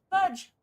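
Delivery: sudden loud sneeze blurting out fudge; real sneeze. Real, not acted. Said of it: "I must sneeze".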